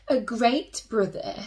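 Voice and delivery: plain reading voice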